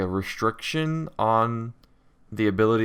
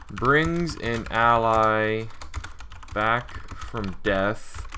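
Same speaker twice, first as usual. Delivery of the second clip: very slow, drawn-out words; dictating while typing; much slower than usual. Real, not acted. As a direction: read it out slowly as you type, pausing between chunks.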